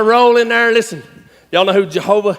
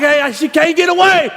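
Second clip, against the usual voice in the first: urgent panic; 7 semitones higher than usual. Retold or imitated. Acts out panic as cow escapes.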